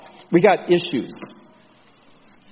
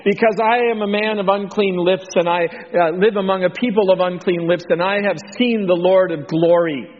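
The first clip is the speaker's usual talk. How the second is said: dramatic confession, pitch raised; acted alarm; 6 semitones higher, 3 dB louder than usual. Isaiah confessing unclean lips before God, raised voice of alarm. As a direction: proclaim it in hammed-up dismay.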